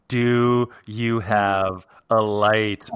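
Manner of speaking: slow mock Australian. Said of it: "He goes".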